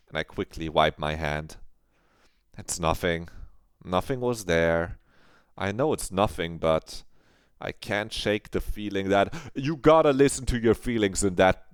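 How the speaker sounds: normal talking voice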